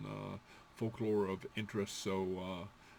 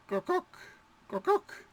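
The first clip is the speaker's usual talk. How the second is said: high hen cluck. Shown as a demonstration. Teacher clucking like a hen, high.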